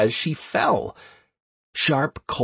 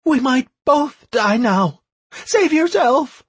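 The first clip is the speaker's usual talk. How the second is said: high, louder pleading woman; woman's voice; 14 semitones higher, 6 dB louder than usual. Acted. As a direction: beg him to let go.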